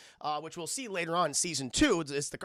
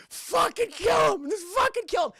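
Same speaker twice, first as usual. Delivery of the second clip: enraged Cabbage Man; 14 semitones higher, 6 dB louder than usual. Retold or imitated. Cabbage seller demanding death, mock-furious screech. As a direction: scream for blood, hammed-up. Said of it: "he's like".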